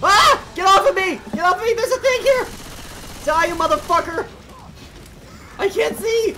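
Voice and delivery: high, loud frantic yelling; panicked shouting. Real, not acted. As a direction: yell in panic while swarmed. Swarmed by zombies, shouting.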